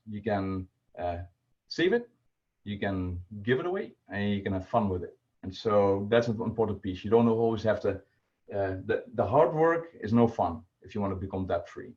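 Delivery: normal talking voice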